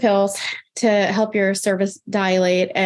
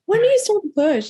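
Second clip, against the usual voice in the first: much higher, innocent mock-naive ask; naive high question; 12 semitones higher than usual. First-time mom asking nurse when to push, mock-naive.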